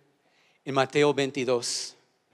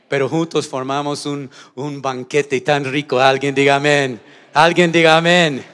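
rising to a loud preacher's call; preaching at full force; 11 dB louder than usual. Acted. Pastor calling his church to answer, building to a shout. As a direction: build the crowd up to the amen.

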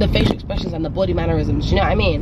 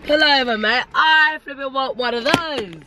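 higher, slower mock bloke begging for ice cream; hammed-up passerby; 6 semitones higher than usual. Vlogger hams up a passerby begging for a Mister Whippy, higher mock voice. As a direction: push it up into a pleading cartoon bloke.

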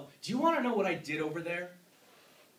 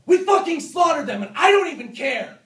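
screaming in self-loathing rage; furious shout; 11 semitones higher, 14 dB louder than usual. Acted. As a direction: yell it out with raw fury. Screaming that he's a monster.

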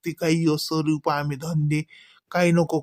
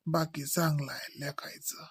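breathy whispered aside, voice dropped right down; 13 dB quieter than usual. Real, not acted. A teasing whispered aside to her friend about the daughter. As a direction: let the compliment slip out as a sly whisper.

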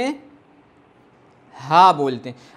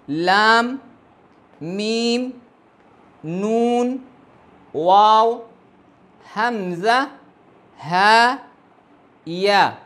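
alphabet recitation; 2 semitones higher, much slower than usual. Shown as a demonstration. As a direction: recite the letters clearly for learners to repeat.

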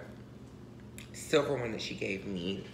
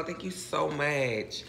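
usual speaking voice

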